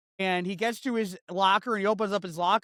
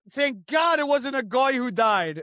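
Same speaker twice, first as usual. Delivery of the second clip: mock messenger; 5 semitones higher, 5 dB louder than usual. Telling the family the news, hammed-up herald. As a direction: proclaim the news like a relieved town crier.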